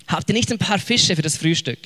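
ordinary talk

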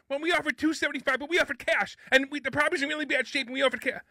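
high, complaining imitation of a pushy realtor; whiny realtor voice. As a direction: realtor yelling at the broker, high mocking voice. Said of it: "Can you do the rest of the show with that voice".

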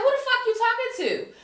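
high-pitched, mock-outraged challenge; indignant outburst. Woman answers officer who denies having her ID, indignant outburst. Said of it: "So then I just lose my shit".

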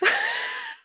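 a very high squealing burst of laughter; shrieking laugh. Real, not acted. Reacting to the absurd plot.

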